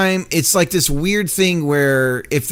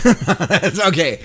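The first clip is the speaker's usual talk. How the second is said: laughing it off; much slower than usual. Real, not acted. Laughing off mix-up.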